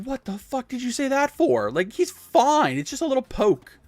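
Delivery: high, loud scolding grandma; exasperated grandma. Retold or imitated. Grandma telling the mom off, high and loud. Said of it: "It's like".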